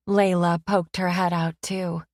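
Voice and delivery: ordinary delivery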